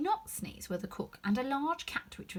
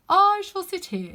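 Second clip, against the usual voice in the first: footman voice; 10 semitones higher, 12 dB louder, slower than usual. A narrator's reading. Footman repeating his remark.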